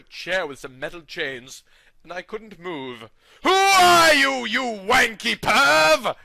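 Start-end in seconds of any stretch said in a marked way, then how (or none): 3.4-6.1 loud, high, slow shout; hammed-up furious yell. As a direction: bellow it at the captor, drawn out. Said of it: "I shouted".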